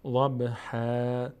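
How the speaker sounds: ضبحا ending in a long open -haa, no n; ضبحا with tanween turned to long a; pausal reading. Shown as a demonstration. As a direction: stretch the last vowel of ضبحا slowly. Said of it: "وقتی که بخوایم توقف بکنیم".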